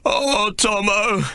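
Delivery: high, slow, sobbing wail; a broken-hearted sobbing cry; bawling. Acted. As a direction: cry out his name in grief. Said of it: "he bawls".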